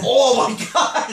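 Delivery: high shocked cry. Real, not acted. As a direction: gasp it out slowly.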